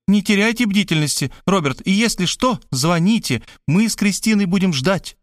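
high call after someone. Acted. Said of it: "крикнула вслед".

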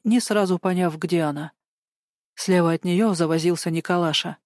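ordinary delivery, nothing marked

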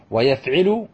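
ويفعل as wa-yaf-'i-lu, rising; middle vowel I. Shown as a demonstration. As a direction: list ويفعل with kasra on the ayn. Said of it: "ثلاثة أبواب في مضارعه".

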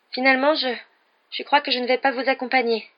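high, hesitant little voice; small high voice. A narrator's reading. Hermione answers the boys from her cabin, small and high-pitched. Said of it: "répondit une petite voix aiguë".

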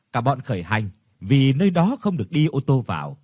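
ordinary delivery, nothing marked

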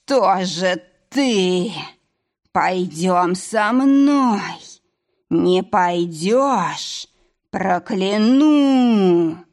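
медленный потусторонний голос, угроза; тянуть слова, как утопленник; голос призрака. Acted. Ghost lures and threatens hero. (зловещий голос призрака)

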